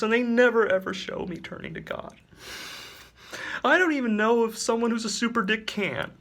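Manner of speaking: a choked, pleading superhero; Dick's earnest voice. Acted. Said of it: "Dick says".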